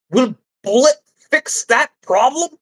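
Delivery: normal talking voice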